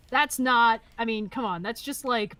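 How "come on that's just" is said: normal talking voice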